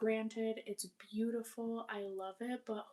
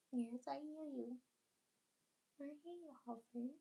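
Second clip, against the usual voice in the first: pet talk; 5 semitones higher, 5 dB quieter than usual. Real, not acted. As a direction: squeak it up high to the little guy. Cooing at the cat.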